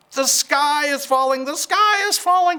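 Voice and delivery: very high, loud, frantic chicken voice; panicked clucking hen. Acted. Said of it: "clucked Chicken Licken".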